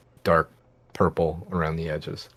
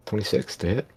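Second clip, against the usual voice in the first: ordinary delivery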